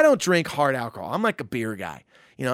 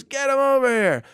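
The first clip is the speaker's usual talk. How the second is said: high, loud beckoning holler; imitated expansive summons; calling out; 10 semitones higher, 5 dB louder than usual. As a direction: shout for the whole gang to join. Star summons the band over.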